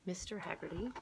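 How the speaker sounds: ordinary delivery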